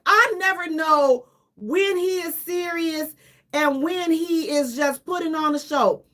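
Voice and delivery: usual speaking voice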